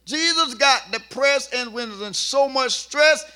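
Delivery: loud, high, ringing sermon climax; full-force preaching. Real, not acted. Pastor preaching to his congregation, at full force. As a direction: preach it out to the whole church.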